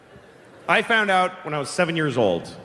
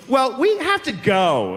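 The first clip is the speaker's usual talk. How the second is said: high, stretched-out, hasty exit line; abrupt excuse; 7 semitones higher, slower than usual. Retold or imitated. Mother losing the argument to a child, high-pitched excuse. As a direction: announce it loftily to escape the argument. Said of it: "all of a sudden she goes".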